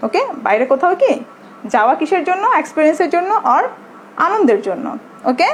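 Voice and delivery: usual speaking voice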